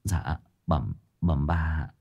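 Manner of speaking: soft, hesitant murmur to the mistress; timid servant mumbling; mumbling timidly. A narrator's reading. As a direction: mumble it under your breath. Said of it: "lý nhí với bà".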